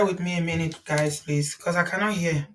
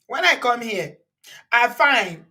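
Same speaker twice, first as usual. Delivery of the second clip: lightly acted boastful quote, higher and louder; playful mock of a performer, raised voice; 6 semitones higher, 5 dB louder than usual. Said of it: "Portable said ... He said".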